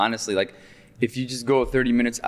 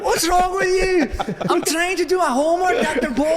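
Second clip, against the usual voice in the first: put-on Scottish accent. Student mocking his professor, much higher laughing Scottish accent. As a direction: throw his Scottish accent back at him, giggling. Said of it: "my Scottish, uh, accent".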